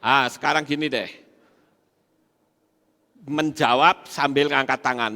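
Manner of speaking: normal talking voice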